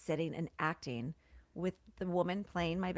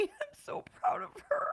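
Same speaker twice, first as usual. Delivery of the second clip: tearful. Real, not acted.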